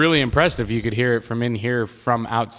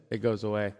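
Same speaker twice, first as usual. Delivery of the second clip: quieter, lower, turned away; turned off microphone; 3 semitones lower, 10 dB quieter than usual. Shown as a demonstration. Co-host demonstrating the microphone, voice dropping away. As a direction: say it facing away from the mic. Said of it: "when you go like this".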